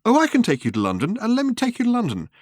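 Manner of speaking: blurted offer. A narrator's reading. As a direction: let the offer run away from him. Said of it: "with horror".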